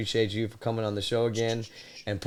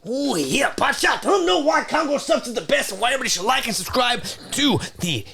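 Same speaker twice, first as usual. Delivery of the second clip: wrestling promo; 4 semitones higher than usual. Pretend. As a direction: cut a promo like a wrestler in the ring. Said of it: "cut a promo live".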